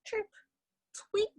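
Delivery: short frog, cricket and bird calls in turn; animal calls. Acted. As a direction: imitate three critters, one brief call each. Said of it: "the three of them were like".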